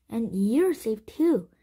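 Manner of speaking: loud, slow, glad exclamation; relieved. A narrator's reading. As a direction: share the relief, stretching the words. Relief that friend is safe.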